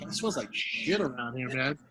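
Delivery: low, soft puzzled mutter; quiet mutter. Retold or imitated. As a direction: grumble it to yourself. Muttering about smell.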